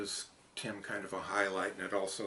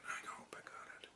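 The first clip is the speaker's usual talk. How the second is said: fast breathy whisper to self; quick nervous whisper; 14 dB quieter, faster than usual. Real, not acted. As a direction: whisper it as a hopeful aside. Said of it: "repeated softer".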